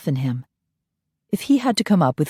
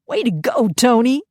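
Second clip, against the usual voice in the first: higher, bright praise; cheering; 7 semitones higher than usual. Acted. Guard praising the nanny, cheering. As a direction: cheer her on.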